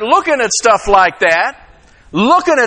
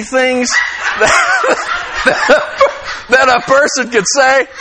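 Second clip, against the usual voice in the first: wife laughing; 4 semitones higher, much slower than usual. Retold or imitated. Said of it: "she said".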